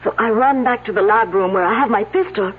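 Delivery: put-on light French accent throughout. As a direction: speak English with a French lilt. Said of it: "unless someone's imitating her accent".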